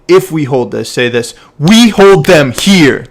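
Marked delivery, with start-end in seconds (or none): 1.6-3.0 loud, slow, higher-pitched command; direct statement. Shown as a demonstration. Coach shows coxswain how to state the call, direct command. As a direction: drive each word home as a firm order. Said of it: "you make that into statements, you make them clean, very direct".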